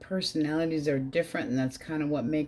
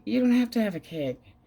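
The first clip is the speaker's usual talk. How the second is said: exasperated retort; 7 semitones higher than usual. Retold or imitated. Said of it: "I'm like".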